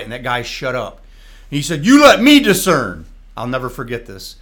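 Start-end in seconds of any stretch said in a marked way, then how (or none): 1.8-3.0 loud, higher, stern command; preacher taking control of the service, loud and stern. Retold or imitated. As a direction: assert full authority over the room.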